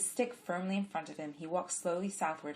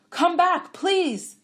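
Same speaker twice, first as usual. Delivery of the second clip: high, loud plea to return; pleading yell; 14 semitones higher, 14 dB louder, much slower than usual. A narrator's reading. Blind man begging the woman to return, loud yell. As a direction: call her back with urgency. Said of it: "Adiver yelled".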